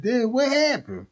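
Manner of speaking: a hammed-up pontiff, suddenly curious; Pope. Pope voiced in a skit.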